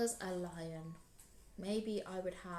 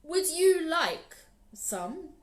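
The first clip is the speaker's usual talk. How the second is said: big proud lion; 9 dB louder than usual. Acted. Lion boasts to Anansi about his lunch, loud and grand. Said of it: "said Lion".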